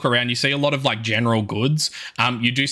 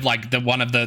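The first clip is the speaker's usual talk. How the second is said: ordinary talk, nothing marked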